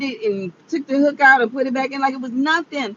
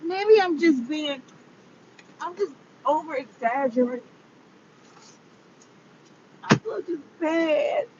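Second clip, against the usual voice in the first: crying; 7 dB quieter than usual. Real, not acted. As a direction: say it weakly through tears. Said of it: "I'm sitting up here crying".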